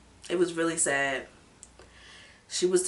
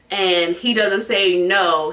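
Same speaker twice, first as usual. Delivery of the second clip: ordinary delivery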